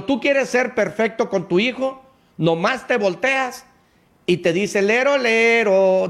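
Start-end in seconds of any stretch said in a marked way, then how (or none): 4.8-6.1 put-on sing-song childish teasing, high and drawn out; mocking child taunt. A parent voicing his son's teasing, in a sing-song child voice. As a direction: tease like a bratty kid, slowly.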